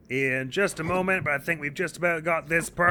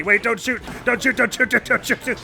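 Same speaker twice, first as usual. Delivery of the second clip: frantic pleading, higher and higher; panicked surrender shout; 7 semitones higher than usual. Real, not acted. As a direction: beg it not to shoot, in a rush. Lynel drawing bow at him.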